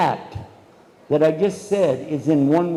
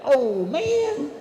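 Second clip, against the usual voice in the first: put-on a much higher, drawn-out kid's whine; whiny complaint; 10 semitones higher than usual. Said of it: "You're like".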